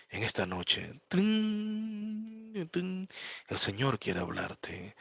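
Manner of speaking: soft, slow, hushed preacher voice with piano plinks; mock hushed preacher. Mocking a pastor who needs piano to preach, soft and slow. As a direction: croon like a pastor leaning on the piano. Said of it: "no podía predicar si no tenía al pianista".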